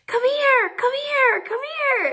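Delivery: high, eager call across the yard; excited calling. Retold or imitated. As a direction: call her over, bursting with excitement. Mom calls daughter over excitedly.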